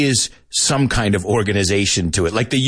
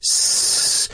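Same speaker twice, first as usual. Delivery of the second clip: imitated snake hiss, a long clean S; child's snake hiss. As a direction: hiss like a little kid playing snake. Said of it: "sometimes she says".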